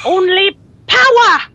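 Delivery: punched mock boss cry; hammed-up villain catchphrase; dungeon boss. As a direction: blurt it out like an over-the-top game boss. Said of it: "The dude from one of those end time dungeons".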